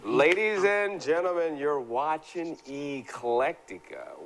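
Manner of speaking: big show-opener announcement; announcer voice. Acted. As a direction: boom it out like a TV host. Show host opens the episode.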